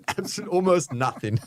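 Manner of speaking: laughing. Real, not acted. Co-host on the meltdown being for nothing, laughing. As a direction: crack up mid-sentence.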